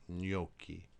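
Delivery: gnocchi with gn as ny, NYO-kee; Italian gn as ny; Italian gn sound. Shown as a demonstration. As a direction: repeat gnocchi for students, ny sound. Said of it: "sounds like the N as in onion".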